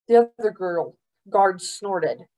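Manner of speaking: ordinary delivery, nothing marked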